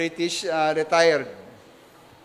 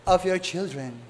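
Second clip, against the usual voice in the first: put-on British accent; 3 semitones lower, 3 dB quieter than usual. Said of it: "sabi niya".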